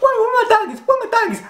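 high whiny mock grief; mock sad whimper. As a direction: whimper for your lost dogs, hammed up. Himself grieving in bed.

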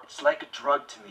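strained, pained, low. Shown as a demonstration. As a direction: squeeze the words out through pain. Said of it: "Even more".

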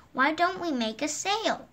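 ordinary delivery, nothing marked

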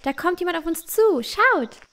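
put-on a girl calling out in alarm and excitement; excited call. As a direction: call out eagerly to her friends. Anna spots approaching people.

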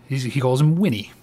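usual speaking voice

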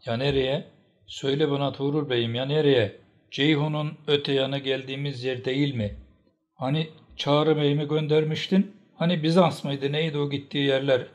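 heated cross-examination; angry challenge. A narrator's reading. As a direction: throw the questions at him accusingly. Angry woman challenges the bey's answer.

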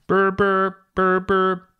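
put-on high, loud burr burr of an old phone; imitated phone ring. Narrator mimics the phone ringing, high burr burr.

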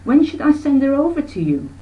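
a mother asking politely; mother's question. Acted.

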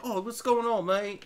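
lightly voiced English band guy greeting; a hint of an English accent. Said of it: "they're from England".

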